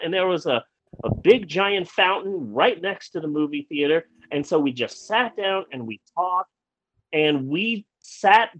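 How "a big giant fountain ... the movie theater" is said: plain reading voice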